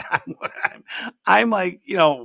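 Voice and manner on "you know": ordinary talk, nothing marked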